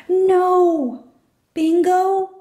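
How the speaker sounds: high, loud cry for the dog; a girl shouting after her runaway dog; desperate high call; panicked yell. A narrator's reading. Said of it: "she yells as loud as she can".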